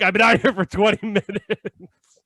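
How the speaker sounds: slow, tired, drawn-out grumble; slow weary complaint. Retold or imitated. Fallen man tells the narrator he's waited, slow and weary. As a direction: imitate a fallen man drawling his complaint. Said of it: "But he's like".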